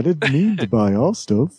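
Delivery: goofy high cartoon dog voice. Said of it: "I sounded like a cartoon dog instead of [name]".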